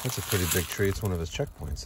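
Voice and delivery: usual speaking voice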